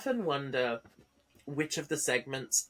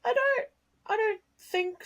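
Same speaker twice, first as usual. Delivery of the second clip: mock crying; slower than usual. Fake crying answer.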